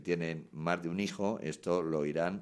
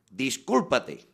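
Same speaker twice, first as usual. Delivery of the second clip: loud, higher snapped order; sharp demand; 6 semitones higher, 9 dB louder, much slower than usual. Retold or imitated. Partner fed up after days of conflict, snapping a loud order. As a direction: bark the demand at your partner.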